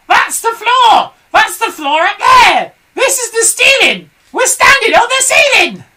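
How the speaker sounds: very high, loud panicked hag; Mrs Twit screaming. A narrator's reading. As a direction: screech in disbelief. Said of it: "screamed Missus Twit".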